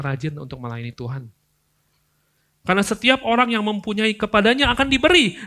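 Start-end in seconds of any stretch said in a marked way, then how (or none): 2.7-5.4 high, loud preaching; full-force preaching. Real, not acted. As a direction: build into a loud climax.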